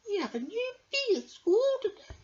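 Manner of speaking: high, bright queen bee voice. Acted. The queen bee greets her pupils at school. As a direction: greet the class like a grand queen. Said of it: "said the queen".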